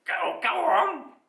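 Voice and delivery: slow, loud urging with a touch of husk; husky lion. A narrator's reading. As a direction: nudge someone forward, slightly husky. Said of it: "said the lion huskily".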